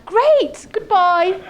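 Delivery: very high, loud mock farewell; emphatic send-off. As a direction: act out an eager dismissal. Acted brisk send-off at Heathrow.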